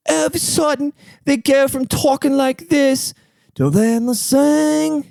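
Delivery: exaggerated very high British voice; slow, high-pitched mock British; British accent. Comic wondering where accents go in singing, mock British accent. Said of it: "still had a British accent".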